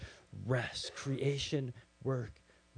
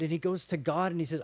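usual speaking voice

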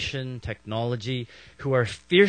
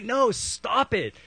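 high, drawn-out "no, stop it"; mock-exasperated plea; acted pleading objection; 9 semitones higher, slower than usual. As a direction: urge them to stop, slow and pitched up.

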